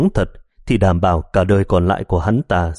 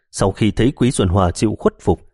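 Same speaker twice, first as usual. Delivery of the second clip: ordinary delivery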